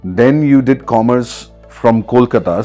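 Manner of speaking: ordinary delivery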